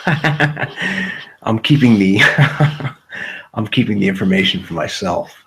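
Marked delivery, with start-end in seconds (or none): 0.1-3.5 words broken up by a real laugh; giggling while speaking. Real, not acted. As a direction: laugh through the line, barely getting words out. Laughing mid-sentence at own joke.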